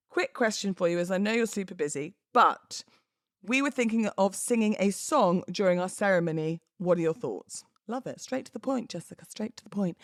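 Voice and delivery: ordinary talk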